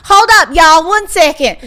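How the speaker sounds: loud, high Southern twang; South Carolina accent. Retold or imitated. Assistant putting the callers on hold, loud South Carolina twang. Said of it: "we need to work on the accent a little".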